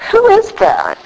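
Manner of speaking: mock-puzzled speaker spotting a stranger in the window; puzzled question. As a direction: pretend not to recognize yourself. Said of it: "and I go".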